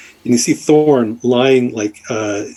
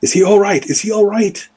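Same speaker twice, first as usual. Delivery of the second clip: high, urgent worried call; worried woman's high-pitched question; anxious calling out; 9 semitones higher than usual. Acted. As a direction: call out for reassurance, pitched well up. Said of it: "she's calling out".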